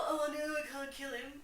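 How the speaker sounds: high-pitched mocking whine; mock dismay. Mocking Hamlet's excuse not to kill, in feigned dismay. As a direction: make fun of a dithering prince.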